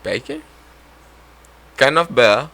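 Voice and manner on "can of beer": Can of beer pitched up and louder in a put-on English accent; attempted English accent. Shown as a demonstration. Friend retries the English accent test, higher and louder. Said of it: "In an English accent".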